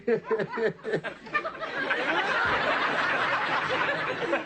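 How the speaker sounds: shrill cackling laughter at his own joke; real helpless high laugh; high squealing laugh. Real, not acted. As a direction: howl with laughter, high and long.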